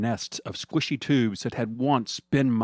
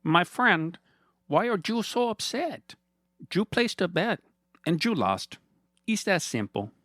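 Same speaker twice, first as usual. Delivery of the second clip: high villain voice with fake pity; sneering villain faking sadness, much higher; mock sadness; 9 semitones higher than usual. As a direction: pout in false sympathy over his loss. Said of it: "in mock sadness".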